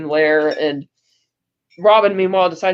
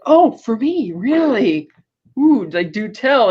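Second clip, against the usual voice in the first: hammed-up delight, swooping higher on each question; gushing Riddler; 5 semitones higher, slower than usual. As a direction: play a villain mock-flattered by a treat.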